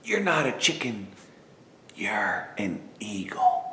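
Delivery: soft whispered secret to the reader. Acted. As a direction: breathe it close like a secret. Intimate final line to reader.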